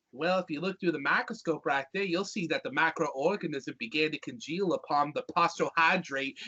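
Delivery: Southern character explaining science; Southern accent. Acted. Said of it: "when a Southern character explains".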